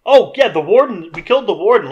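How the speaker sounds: high, loud mock eureka; light up with an idea; sudden idea. Acted quarrel, sudden idea.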